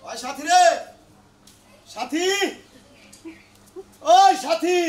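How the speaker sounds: drawn-out call to someone out of sight. Acted. Visitor calling out for Sathi at the house, calling. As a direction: call a name out, rising at the end.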